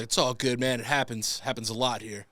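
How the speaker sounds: laid-back trainer. Retold or imitated. Trainer's reply, gym guy voiced.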